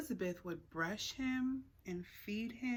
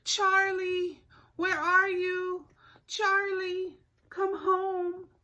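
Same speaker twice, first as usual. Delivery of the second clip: high loud call into the storm; 10 semitones higher, 11 dB louder, much slower than usual. Acted. Girls calling the lost cat from the deck, loud call. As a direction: call far into the woods, worried. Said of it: "called and called his name".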